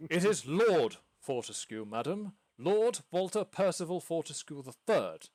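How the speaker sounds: stuffy lord correcting a lady; offended lord. Acted. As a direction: insist on the full title, put out. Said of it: "put out and go".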